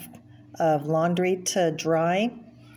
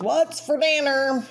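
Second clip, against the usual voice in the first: higher, put-on cartoon husband; husband's cartoon voice; 8 semitones higher, 3 dB louder than usual. Cartoon husband asking his wife about dinner, put-on voice. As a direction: ask it like a hungry cartoon husband. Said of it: "He says".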